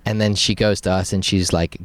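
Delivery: normal talking voice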